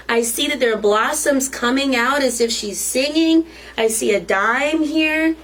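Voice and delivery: usual speaking voice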